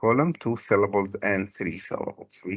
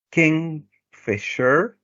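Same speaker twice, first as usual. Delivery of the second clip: KINGFISHER drawn out slowly in separate syllables, rising at the end; 6 semitones higher, much slower than usual. Shown as a demonstration. Teacher showing the class how many syllables, syllable by syllable. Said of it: "how many syllables we can say".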